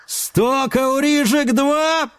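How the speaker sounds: slow, high auctioneer chant; auctioneer call. Acted. Auctioneer calling bids.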